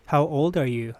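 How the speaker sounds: ordinary talk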